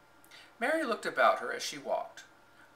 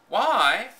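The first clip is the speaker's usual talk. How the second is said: higher, louder incredulous why; incredulous echo; 9 semitones higher, 10 dB louder than usual. A narrator's reading. Maid echoing the girl's question, incredulous. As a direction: throw the word back, astonished. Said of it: "echoed Martha".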